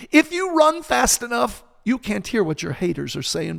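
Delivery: high, loud preacher's cry; carried-away preaching. Real, not acted. Preacher carried away on his podcast, full-force preaching. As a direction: launch it at the congregation, high and loud. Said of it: "I don't like to preach".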